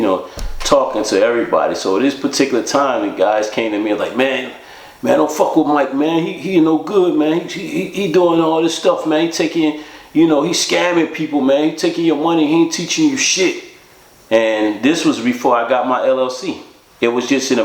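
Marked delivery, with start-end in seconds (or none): 4.2-13.6 raised pitch, pressing, repeated "man"; urgent warning. Retold or imitated. Inmates warning the narrator about Mike, urgent and raised. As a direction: warn him hard, like spreading gossip.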